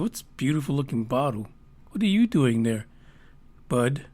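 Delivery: hushed admiring sweet talk; cooing to the bottle. Retold or imitated. To a beer bottle he admires, soft cooing.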